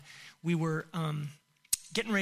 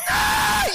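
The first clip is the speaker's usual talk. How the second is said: piercingly high, loud shriek; imitated child's scream; 11 dB louder than usual. Little girl as the big fish comes up beside her, imitated screaming. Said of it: "she screams like".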